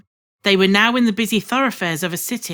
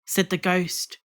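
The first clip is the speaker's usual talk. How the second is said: ordinary delivery, nothing marked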